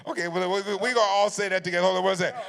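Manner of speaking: loud, high, excited crowd wrangling; rallying the crowd. Real, not acted. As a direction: shout over the laughter to organise everyone. Rallying a laughing audience.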